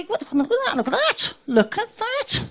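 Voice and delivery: high, rising wonder; excited gawping. Retold or imitated. Shopper marvelling at a wine label, high and excited. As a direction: point and marvel. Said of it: "you go".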